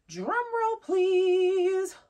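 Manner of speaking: high, slow, stretched out drum roll please; drum roll announcement. Acted. Vlogger teasing her perfume reveal to viewers, hammed-up drum roll. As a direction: build up the big reveal like a showman.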